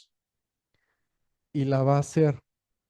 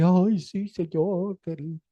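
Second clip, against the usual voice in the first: mock pious weeping; 4 semitones higher, slower than usual. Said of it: "que alce las manos y que llore".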